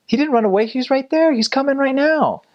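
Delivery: high, insistent rebuttal; exasperated protest. Retold or imitated. Missionary correcting the wife about his companion, exasperated protest. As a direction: protest in a squeaky, incredulous rush.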